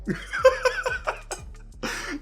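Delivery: high, breath-driven laughter; long giggling laugh after a joke; joking laugh. Pretend. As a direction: laugh it up at your own dark joke. Laughs at own joke, just kidding.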